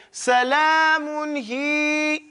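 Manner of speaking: salamun hi stretched slowly; slow recitation. Shown as a demonstration. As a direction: draw salamun hi out. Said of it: "we would say".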